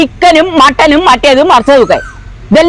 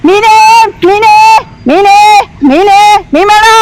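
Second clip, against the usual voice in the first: short vendor cries; calling out wares; 4 semitones higher, slower than usual. Acted. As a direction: hawk fish down the lane. Fish seller calling out. (മീൻ വിളിച്ചു പറയുന്നു)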